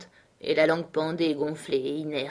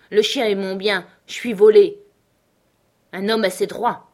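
louder, higher cry of grievance; indignant protest; 3 semitones higher, 8 dB louder than usual. A narrator's reading. Beauty Smith protesting to Scott over his dog, indignant. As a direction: protest loudly like a man being cheated. Cowed man protests being robbed.

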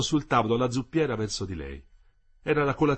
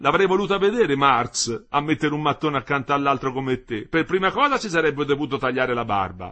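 louder, lively mocking wife; Ersilia, teasing contradiction; 4 semitones higher, 7 dB louder than usual. A narrator's reading. Wife enjoys contradicting her husband about Marx, teasing and loud. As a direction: needle him with a raised voice.

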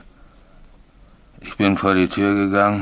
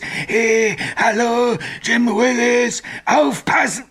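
ghostly call; slower than usual. Retold or imitated. Signalman cries out the ghost's words, eerie wail. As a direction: wail it like the haunting voice.

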